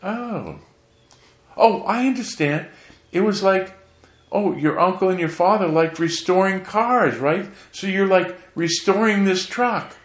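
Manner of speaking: high, put-on voice of a pampered aunt; imitating rich aunt. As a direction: squeak it out like a fussy rich auntie. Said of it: "she said".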